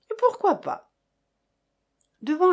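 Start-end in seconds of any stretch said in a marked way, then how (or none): 0.1-0.9 defiant reply. A narrator's reading.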